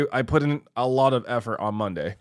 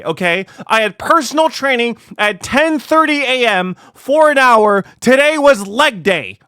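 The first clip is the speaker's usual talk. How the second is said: high, loud defensive rant; yelling; 9 semitones higher, 11 dB louder than usual. Real, not acted. Streamer defending leg day to a chatter, yelling. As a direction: shout it down indignantly. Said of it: "Stop yelling".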